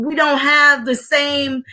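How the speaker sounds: usual speaking voice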